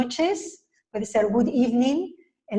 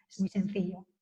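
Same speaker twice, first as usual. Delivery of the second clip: whisper; 2 semitones lower, 7 dB quieter than usual. Real, not acted. Teacher's closing aside.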